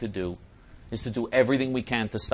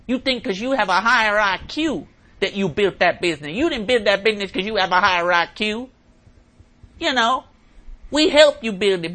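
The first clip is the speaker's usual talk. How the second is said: Obama; 10 semitones higher, 10 dB louder than usual. Retold or imitated.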